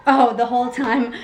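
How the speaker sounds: ordinary delivery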